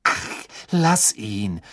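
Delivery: slow, disgusted dismissal. A narrator's reading. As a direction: turn away in disgust. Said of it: "mit einem ekelerfüllten Blick".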